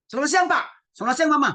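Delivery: loud, high barked greeting; high intonation. Shown as a demonstration. Child greeting parents rudely, high and loud. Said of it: "kalian ngomong begitu sama orang tua".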